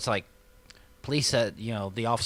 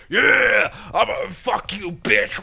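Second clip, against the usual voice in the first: loud high put-on tough-guy yell; mock thug threat; 10 semitones higher, 9 dB louder, slower than usual. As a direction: bark it like a drunk picking a fight. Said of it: "It's like".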